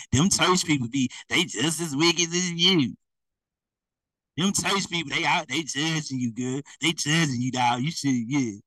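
a hint of a sly tempter, easygoing; the spirits lightly voiced telling a believer to distrust church; spirit's voice. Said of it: "That's them spirits talking to you".

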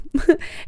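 breathy laugh through tears; a little teary laugh. Real, not acted. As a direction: laugh it off, a bit choked up. Embarrassed laugh at tearing up.

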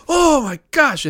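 high, loud imitation of his African accent; mock African accent. Graduate from Cameroon marvelling at the cold, in a mock African accent. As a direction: squeal it like the grad in his accent. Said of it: "in his accent".

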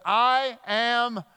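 high, loud, weighty words; emphatic declaration. Retold or imitated. Jesus answers the leper with authority, emphatic declaration. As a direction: proclaim it with authority.

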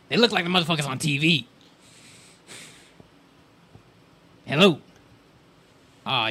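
talking dog. Acted. A dog warning its owners, in a hyper, distractible voice. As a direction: yap out your warning but keep getting sidetracked by food. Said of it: "impression of a dog that gains the power of speech".

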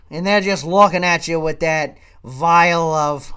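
ordinary talk

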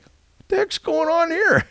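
a bewildered yelp at a stranger's hug; startled shout. Retold or imitated. A stranger hugs him at a car show, startled yelp. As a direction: shoot way up high in sudden shock.

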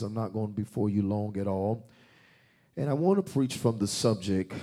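usual speaking voice

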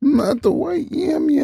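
high, slow, drawn-out protest; mock protest. Answering the teasing friend in a slow mock protest. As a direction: stretch it out in mock dismay.

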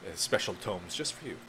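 gracious, formal manservant; butler voice. Acted. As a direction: thank them like a courteous butler. Tormund thanks the player.

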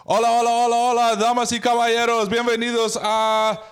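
booming Spanish game-show host. Acted. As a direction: announce it high and loud like a variety show. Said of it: "I've been super excited to do this".

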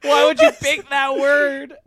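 high, loud near-shout of disbelief; yelling in mock outrage; exasperated outburst. Real, not acted. Friend yells at the typist over the spelling, loud disbelief.

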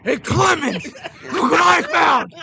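imitated drill instructor's high, loud yell; retold triumphant holler; screamed announcement. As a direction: shout it across the room to a colleague. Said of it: "and he screams".

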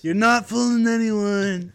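loud slow sneer; slow mocking taunt. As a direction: drag out the taunt. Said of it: "mocking jab".

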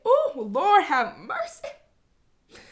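loud, high, drawn-out cry for mercy; overwhelmed exclamation. Real, not acted. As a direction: let the emotion burst out in a long, swooping plea. Overcome by emotion, exclaims.

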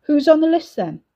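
high, loud demanding call. A narrator's reading. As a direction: raise the voice to carry. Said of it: "Louder, he said".

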